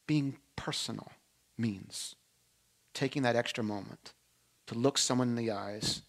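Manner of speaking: tender appeal. Real, not acted. Sermon close, intimate appeal.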